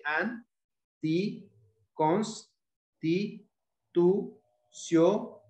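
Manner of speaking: "an, ti, cons, ti, tu, sio", each syllable apart with a rising end; separated syllables. Shown as a demonstration. Teacher counting off a word's syllables, "an, ti, cons, ti, tu, sio" separately. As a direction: sound out "an, ti, cons, ti, tu, sio" one by one. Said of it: "cuando estamos separando la sílaba".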